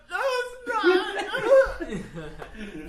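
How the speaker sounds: very high, very slow laughing wail. Retold or imitated. Crying kid answering sister, high slow laughing wail. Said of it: "I'm like".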